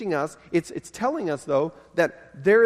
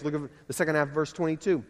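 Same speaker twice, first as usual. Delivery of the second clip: ordinary delivery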